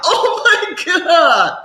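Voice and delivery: high, slow, dramatic fainting sigh; mock fragile swoon. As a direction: overact the collapse over the weather.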